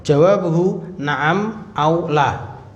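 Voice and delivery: normal talking voice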